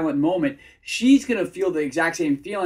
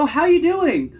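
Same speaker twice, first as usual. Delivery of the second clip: mock eager guy, high and loud, rising like questions; voice octave up; 11 semitones higher, 7 dB louder than usual. Coach imitates guys trying too hard with girls, high and over-eager. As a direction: imitate a nervous people-pleaser pitched way up. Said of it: "their voice ends an octave up, almost as if they're asking a question".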